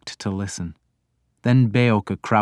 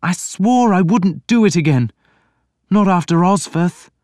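put-on higher, louder young prince voice; a troubled prince pleading; Alfred, anguished prince; 10 semitones higher, 6 dB louder than usual. A narrator's reading. Reader voicing Alfred to his priest, higher and louder.

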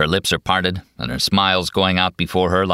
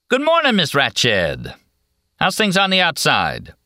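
high, drawn-out hello; breezy sing-out, falling at the end; cheeky cheerful greeting; 10 semitones higher than usual. A narrator's reading. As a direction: greet her brightly with a teasing grin. Grinning McMurphy greets the nurse.

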